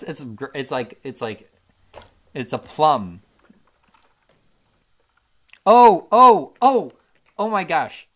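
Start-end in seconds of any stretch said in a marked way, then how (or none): 5.6-8.1 loud, high excited oh my gosh. Real, not acted. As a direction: burst out in recognition. Recognizes the food.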